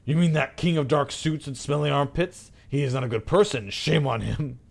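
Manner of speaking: lightly voiced outraged man; indignant man behind door. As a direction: denounce him with righteous indignation.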